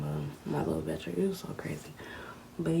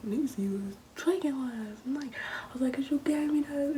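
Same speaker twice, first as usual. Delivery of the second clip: high whispery exchange; teasing and retort; 10 semitones higher than usual. Retold or imitated. Arguing with Tony about last night, high and whispery. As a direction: toss back the blame. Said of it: "he was like".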